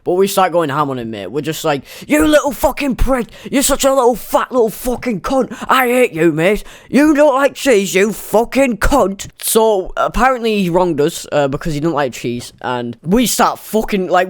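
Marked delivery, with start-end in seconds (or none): 2.1-9.3 high, put-on taunting yell; jeering like a nasty kid in chat; mock insults. Group chat insults acted out.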